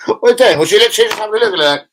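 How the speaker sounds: real amused surprise, rushed; laughing surprise. Real, not acted. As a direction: catch him out, laughing. Laughing at mate's glance.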